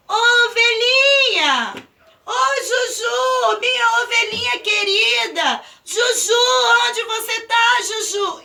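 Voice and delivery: high, loud desperate call. Acted. Pedro calls his lost sheep, shouting desperately.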